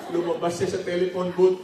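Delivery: usual speaking voice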